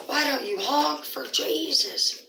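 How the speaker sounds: mock the guy's question; mocking quote. [name] mocks the sign man, slow sing-song.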